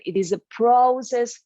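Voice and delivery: "process" lifted in pitch and volume; emphasis on process. Real, not acted. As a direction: make "process" stand out firmly. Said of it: "I would emphasize the word process here".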